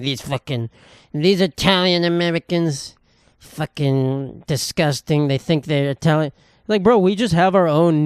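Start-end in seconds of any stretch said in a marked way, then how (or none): 0.0-6.3 slow, disgusted mimicry of a complaint. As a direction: imitate someone dragging out words in disgust. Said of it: "disgusted by what she said".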